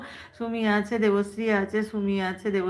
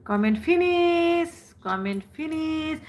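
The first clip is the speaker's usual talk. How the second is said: sing-song announcement; 7 semitones higher, slower than usual. Real, not acted. Announcing comments are done.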